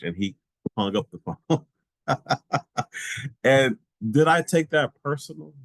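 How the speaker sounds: normal talking voice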